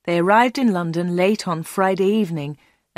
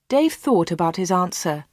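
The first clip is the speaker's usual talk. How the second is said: usual speaking voice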